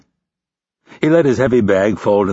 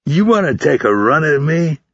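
higher, drawn questioning dare; incredulous challenge; 6 semitones higher than usual. A narrator's reading. Instructor to recruit proposing a fight, incredulous. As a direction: ask it as a dare.

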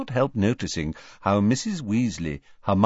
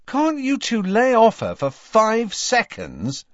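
angry; 11 semitones higher, 4 dB louder than usual. Acted. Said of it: "saying angrily".

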